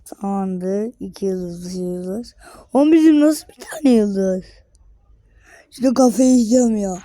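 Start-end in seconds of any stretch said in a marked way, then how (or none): 2.7-7.0 loud, higher cry of outrage; fed up, you're about to crack; outraged shout. Real, not acted. Gamer outraged at his club's one star, loud complaint.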